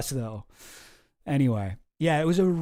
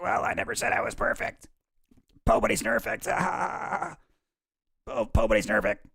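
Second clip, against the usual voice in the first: a higher, strained mock politician's voice, shrugging off the charge; a higher, hammed-up RFK Junior; RFK Junior impression; 5 semitones higher than usual. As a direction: excuse it away in his put-on voice. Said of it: "Sometimes I can do pretty good, R F K Junior".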